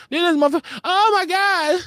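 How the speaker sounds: imitating a friend's shocked high squeal; imitating Courtney. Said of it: "Courtney said".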